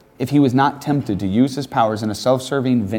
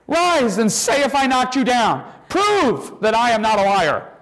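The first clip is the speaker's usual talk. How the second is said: commanding call; 11 semitones higher than usual. Acted. Jesus ordering the dead boy to rise, high ringing command. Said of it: "cried out in a loud voice".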